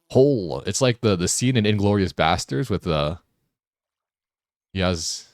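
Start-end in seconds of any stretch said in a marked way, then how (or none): 0.1-0.6 flat American-accent Hola, louder; gringo accent. Shown as a demonstration. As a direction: say Hola like a tourist from Ohio. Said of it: "in the most whitest pronunciation ever".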